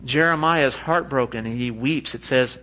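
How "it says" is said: ordinary talk, nothing marked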